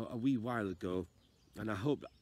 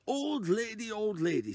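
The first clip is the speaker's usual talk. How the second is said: high, louder anxious plea; pleading man; 11 semitones higher, 6 dB louder than usual. Acted. Unfit man begs the old lady downstairs, high anxious call. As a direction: call out to her, worried. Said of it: "said the man".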